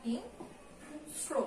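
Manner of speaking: হিংস্র drawn out very slowly and softly as hingsro; slow model pronunciation. Shown as a demonstration. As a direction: stretch হিংস্র with a dental s and final o. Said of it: "দন্ত সটাই থাকবে".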